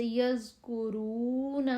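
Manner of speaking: یذکرون with a soft, thin z, drawn out slowly; یذکرون slow, light ذ; soft thin zaal. Shown as a demonstration. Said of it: "زال کی آواز باریک اور نرم ہوتی ہے".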